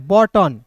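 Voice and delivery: "बटन" with a short a, u silent; right pronunciation. Shown as a demonstration. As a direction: say "बटन" without the u sound. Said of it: "यू को silent करते हैं". ("बटन" का सही उच्चारण)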